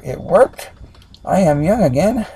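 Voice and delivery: loud, rising disbelief; high, swooping delighted exclamation; amazed exclamation. Acted. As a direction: marvel loudly at being young. Retake of amazed line.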